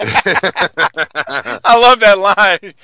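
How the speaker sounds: laughing. Real, not acted. Laughing after quoting line.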